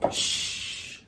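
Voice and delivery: ordinary talk, nothing marked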